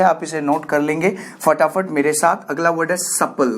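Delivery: ordinary delivery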